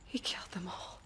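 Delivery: breathless horrified whisper; shocked whisper. Acted. Aloy finds the dead in the control room, whispering in shock. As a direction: barely voice it, stunned by the massacre.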